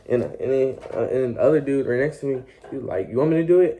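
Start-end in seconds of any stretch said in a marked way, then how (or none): 3.1-3.8 higher, louder questioning voice; retold medic's offer. Second medic offering to do the needle, eager retold question. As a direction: lightly voice the other medic stepping in.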